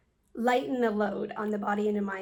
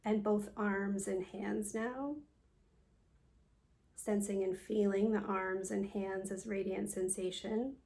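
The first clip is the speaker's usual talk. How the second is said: guided meditation. Acted. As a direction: guide them calmly through a body scan, leaving space after each phrase. Leads a yoga nidra meditation.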